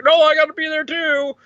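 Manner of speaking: high-pitched, urgent retold outburst; mock heroic insistence. Retold hero insisting on joining.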